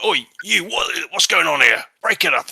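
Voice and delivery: high, loud British constable breaking up a fight; constable shouting. Acted. Constable confronts Bert at the fight, loud and high. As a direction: call out like a bobby cutting in. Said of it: "He's like".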